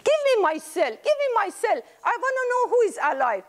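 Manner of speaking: put-on high, fast, flustered old lady; flustered demand. Great-grandma demanding her cell phone, high and flustered.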